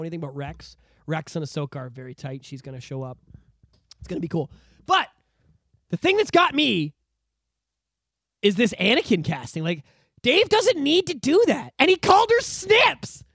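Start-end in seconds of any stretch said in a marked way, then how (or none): 4.9-13.2 high, loud, slow fan gushing; overwhelmed with fan excitement; excited gushing. Real, not acted. Excited fan gushing about Anakin casting.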